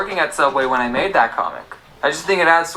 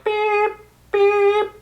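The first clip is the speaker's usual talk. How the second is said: high electronic beeping; alarm beep. Retold or imitated. Imitating the store alarm as he unlocks the door.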